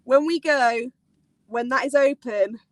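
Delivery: ordinary talk, nothing marked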